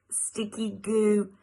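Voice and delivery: ordinary delivery, nothing marked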